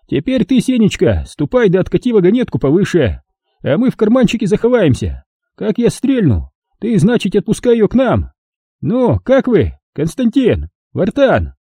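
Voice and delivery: put-on high, gloating old man voice; a cruel old miner mocking his victim; old prospector. A narrator's reading. Old man gives orders.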